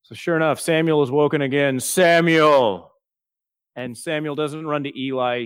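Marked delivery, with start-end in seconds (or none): 1.8-2.9 louder, echoing divine call from afar; voice of God. Acted. God calling boy Samuel in the night, louder and distant. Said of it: "so I'm keeping it".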